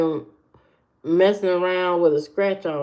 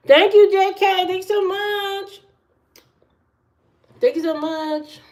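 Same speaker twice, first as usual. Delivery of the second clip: high sing-song thanks; 12 semitones higher than usual. Real, not acted. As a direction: thank them in a squeaky high voice.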